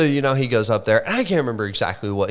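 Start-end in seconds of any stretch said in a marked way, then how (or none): none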